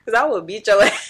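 loud threat breaking into a laugh; real laugh bursting into the threat. Real, not acted. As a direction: crack up loudly on the last words.

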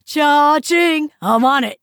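loud, whooping cash-register cheer; excited exclamation. Acted. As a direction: jump on the job with a loud, eager shout. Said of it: "exclaimed Chris".

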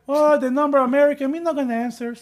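hammed-up Mexican-accented English; imagined Mexican speaker. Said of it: "He must have been like".

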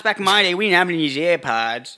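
a hint of a crotchety old man; grumpy old-timer. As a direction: scold the kids like a cranky grandpa. Old-timer mocking kids.

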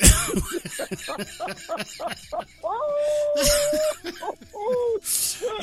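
cracking up high and long. Real, not acted. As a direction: burst into long laughter. Big laugh at own joke.

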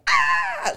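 very high retold scream of excitement; short mock shriek of delight; excited squeal. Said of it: "we were just excited".

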